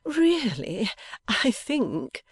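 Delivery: high, airy, playful voice. A narrator's reading. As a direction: protest gaily, pitched well up. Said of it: "still gaily".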